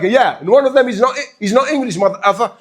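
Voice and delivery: high, loud mock jab; teasing friend. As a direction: imitate a friend ribbing you. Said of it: "he'll make fun of me".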